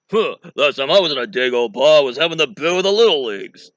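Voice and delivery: loud stand-up comic impression; hammed-up, blustering one-liner; Rodney Dangerfield. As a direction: bellow it like a put-upon comedian. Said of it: "I just can't resist doing Rodney Dangerfield".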